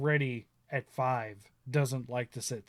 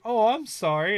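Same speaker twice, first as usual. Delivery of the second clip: higher, louder mock sympathy; sympathetic waitress; 8 semitones higher, 7 dB louder than usual. Dad imitating the waitress's pity, higher. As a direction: play the waitress, overdone pity.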